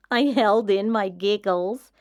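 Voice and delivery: plain reading voice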